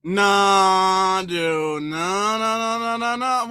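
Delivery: long drawn-out no, climbing in pitch; emphatic disbelief. Real, not acted. Host rejecting a claim in the clip, drawn-out protesting no. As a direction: stretch and chain the refusals, rising steadily.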